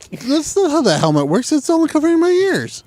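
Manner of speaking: rising mock outrage. Retold complaint about helmets.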